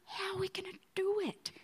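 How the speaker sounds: hushed, anxious whisper to himself; whispered doubt. Retold or imitated. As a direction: whisper it like a secret worry. Inner doubt, thought voiced.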